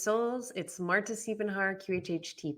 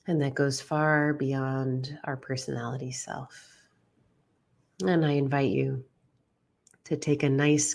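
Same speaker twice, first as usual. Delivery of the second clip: guided meditation. Acted. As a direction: guide them gently inward, leaving space for each breath. Leads a guided visualization.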